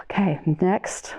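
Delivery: plain reading voice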